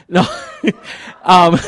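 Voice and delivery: chuckled word after a joke; laughing. Real, not acted. Speaker joking to the congregation about taking it personally, laughing. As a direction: break into a short laugh on it.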